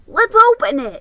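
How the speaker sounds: can't wait another second; eager shout to open it; excited boy. Acted. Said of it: "said Ricky".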